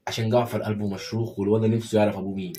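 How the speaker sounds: normal talking voice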